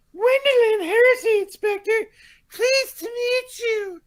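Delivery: high, slow, dainty lady voice. Acted. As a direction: read it as a prim society lady. Reading a lady character's line.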